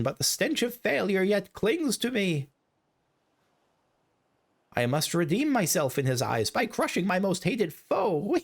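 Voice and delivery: scheming villain. Acted. Villain vowing to crush his most hated foe, gloating. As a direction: gloat over his fall and his revenge.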